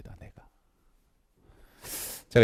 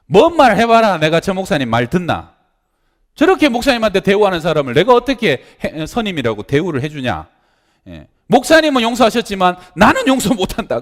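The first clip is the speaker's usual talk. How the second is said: angry defiance; 10 semitones higher, 10 dB louder than usual. Retold or imitated. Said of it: "화가 나갖고".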